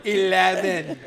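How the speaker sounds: Scottish eleven. Pretend. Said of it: "two Scotsmen on the voice-activated elevator".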